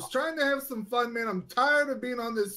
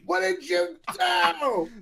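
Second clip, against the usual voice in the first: slow, high, wailing accusation; drawn-out distraught cry; anguished cry; 4 semitones higher, 3 dB louder, much slower than usual. Acted. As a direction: wail it in grief. Owner blames the killer.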